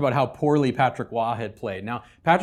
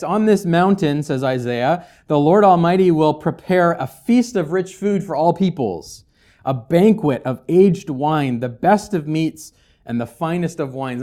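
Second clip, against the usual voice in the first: louder, measured Bible reading; scripture reading; 3 semitones higher, 7 dB louder than usual. Acted. Pastor reading Isaiah to the congregation, proclaiming. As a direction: read the verses out like a proclamation.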